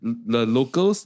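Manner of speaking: ordinary talk, nothing marked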